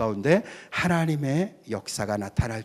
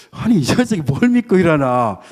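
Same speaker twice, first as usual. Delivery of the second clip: flustered father; 3 semitones higher, 7 dB louder than usual. Retold or imitated. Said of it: "너무 당황해가지고".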